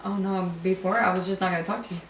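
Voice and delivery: ordinary talk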